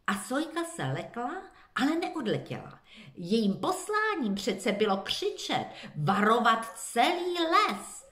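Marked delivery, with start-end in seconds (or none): none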